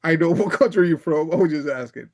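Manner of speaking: laughing through the quote; amused, half-laughing retort. Retold or imitated. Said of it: "He's like".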